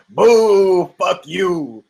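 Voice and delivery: a loud, mocking boo from the crowd. Imitating a hostile New York crowd, loud boo. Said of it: "A New York crowd would be like".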